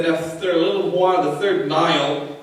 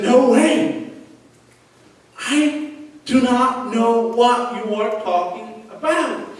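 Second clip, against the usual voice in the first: raised-pitch, slow acted denial; emphatic denial; 5 semitones higher, slower than usual. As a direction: deny it slowly, word by word, pitch up. Acted Peter denying Jesus to accusers.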